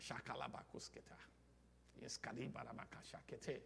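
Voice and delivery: hushed, low speaking in tongues. Shown as a demonstration. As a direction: murmur spirit language very softly. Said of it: "I speak like the Holy Ghost".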